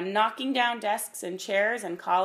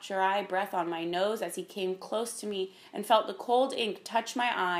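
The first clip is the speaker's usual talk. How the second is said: ordinary delivery, nothing marked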